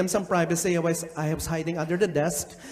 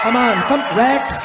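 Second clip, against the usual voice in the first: louder, higher call to the stage; 4 semitones higher, 7 dB louder than usual. Acted. Host calling Rex up to the stage, loud call. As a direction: call him up loudly.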